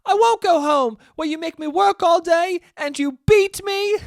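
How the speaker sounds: high, loud put-on kid voice; James dark moment. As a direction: wail it like a hammy child actor. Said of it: "James ... is just like".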